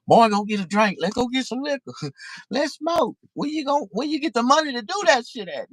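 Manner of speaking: high, loud mocking voice of the idle drinkers; hammed-up Bama buddy begging for liquor; mocking lazy Southern folks.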